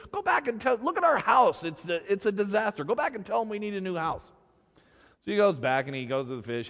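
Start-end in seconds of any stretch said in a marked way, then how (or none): 0.1-4.3 high-pitched, indignant demand; exasperated order. Acted. Wife demands a new house from husband, high, exasperated scolding. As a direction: send him straight back, fed up.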